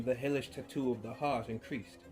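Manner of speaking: plain reading voice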